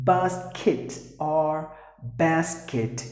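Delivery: slow BASKET as BAHS-kit, then BAS-kit; both pronunciations. Shown as a demonstration. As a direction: contrast open AH and flat A in BASKET. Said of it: "Either way it is".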